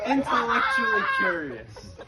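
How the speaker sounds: mock emphasis. Mock-grand praise of dad.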